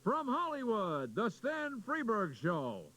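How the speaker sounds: high, slow, drawn-out announcer call; imitating the announcer's grand opening; booming mock radio announcer opening the show; radio announcer intro. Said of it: "the announcer, said the words".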